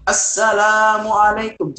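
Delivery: very slow, drawn-out single salam; overdrawn salam. Shown as a demonstration. Teacher shows the overlong salam to avoid, very slow. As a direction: drag it out like a contest speaker. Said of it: "Jangan sampai".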